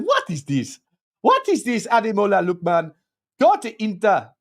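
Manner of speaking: bad mock accent for a Nigerian paper; terrible put-on accent. Streamer mimics a Nigerian paper's headline, terrible put-on accent. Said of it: "That's a terrible accent".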